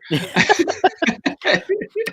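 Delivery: a high, rising real laugh; laughing off a grand claim. Real, not acted. Laughing at his own boast.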